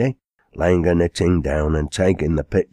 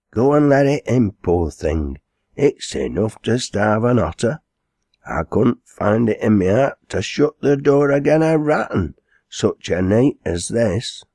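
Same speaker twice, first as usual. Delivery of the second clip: dialect landlady, higher in bursts; lightly voiced landlady, pitying; 5 semitones higher than usual. Landlady sending the girl for the cat, mildly urgent.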